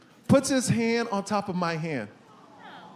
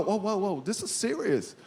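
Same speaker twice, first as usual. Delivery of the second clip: softer, dawning worry; hushed alarm; 7 dB quieter than usual. Retold or imitated. Patient realizing his illness is serious, hushed alarm. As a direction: let it sink in quietly. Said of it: "I go".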